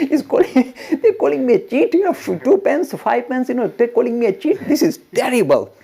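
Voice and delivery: high, loud, affronted protest; mock indignation. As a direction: act out a disciple outraged at an insult. Acted outrage at being called cheat.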